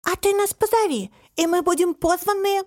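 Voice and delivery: high-pitched friendly suggestion; coaxing little character. A narrator's reading. Тусик proposes to be invited, high and coaxing. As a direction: offer it in a lifted, childlike voice.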